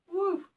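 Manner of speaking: high ghostly woo; a playful spooky whoop. Acted. Spooky sound while reading.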